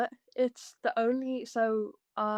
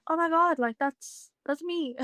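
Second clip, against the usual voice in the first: high-pitched mock "oh my God" moment; imitating her own startled recognition; lightly acted startled self-recognition; retold realisation; 7 semitones higher than usual. Retelling her realisation while learning.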